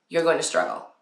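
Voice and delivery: normal talking voice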